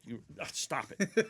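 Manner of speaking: playful scolding. Real, not acted. Teasing the kid in studio.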